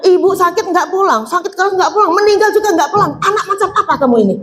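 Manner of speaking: high, fast rebuke building up; angry scolding. Acted. Relative scolds the wife for not coming home, angry rising rebuke. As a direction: scold her with mounting outrage. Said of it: "marah-marah itu".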